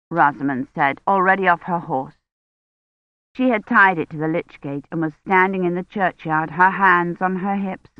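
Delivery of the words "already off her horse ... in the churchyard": ordinary delivery, nothing marked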